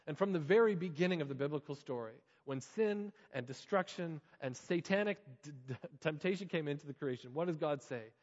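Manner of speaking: ordinary delivery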